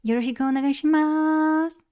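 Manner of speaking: high, sustained Japanese introduction voice. Shown as a demonstration. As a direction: lift the voice way up for a Japanese greeting. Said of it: "I go pretty high".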